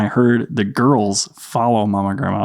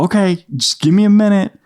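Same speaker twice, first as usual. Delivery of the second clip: high, lilting parent call; dad calling down; 9 semitones higher than usual. Retold or imitated. Dad in the bathroom answering his son, high calling voice. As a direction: put off a little kid in a raised voice.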